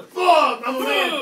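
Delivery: loud, high macho outburst; macho mock strain. Shown as a demonstration. Mocking the guys who push too hard, macho strained shout. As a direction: grunt it out like a show of manhood. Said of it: "You know, the".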